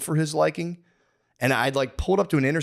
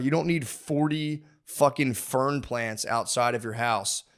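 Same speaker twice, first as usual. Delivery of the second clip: usual speaking voice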